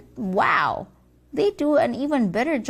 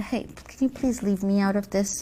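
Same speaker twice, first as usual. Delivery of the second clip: normal talking voice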